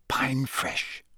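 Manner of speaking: softly muttered password; whispering a secret password. Acted. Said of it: "muttered the password".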